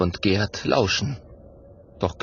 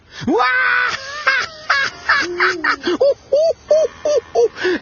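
loud, high, whooping guffaw; long burst of shrieking laughter; ringing cackle tailing into hoots; roaring laughter; 5 dB louder than usual. A narrator's reading. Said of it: "bricht in schallendes Gelächter aus".

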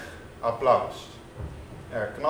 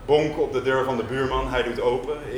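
normal talking voice

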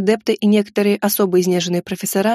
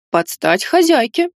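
majestic; 4 semitones higher, slower than usual. Acted. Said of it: "величественно изрёк Эльд".

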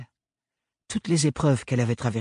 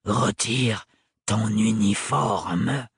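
cold repeated order; 3 semitones lower, much slower than usual. Acted. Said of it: "répéta-t-il".

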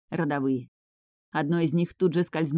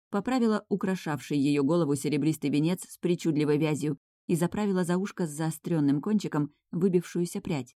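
ordinary delivery, nothing marked

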